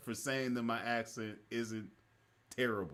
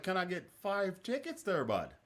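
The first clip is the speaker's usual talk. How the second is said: put-on Wisconsin accent; 8 semitones higher than usual. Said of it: "a dude from Wisconsin".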